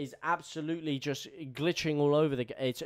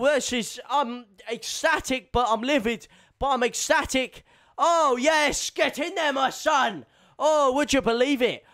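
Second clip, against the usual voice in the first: higher and louder, shouting in disbelief; ecstatic goal celebration; 9 semitones higher, 8 dB louder than usual. Real, not acted. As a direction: lose it over the late equaliser. Equaliser goes in on stream.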